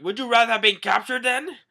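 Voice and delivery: high, loud retort; snapping Zoro. Retold or imitated. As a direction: shoot back a sharp reply. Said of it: "Zoro's like".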